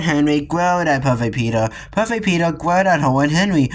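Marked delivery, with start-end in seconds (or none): none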